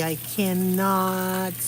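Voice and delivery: ordinary delivery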